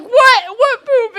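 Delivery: high, loud mock alarm; alarmed disbelief. Retelling friend's reaction to the poop claim, alarmed. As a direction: blurt it out as a startled question.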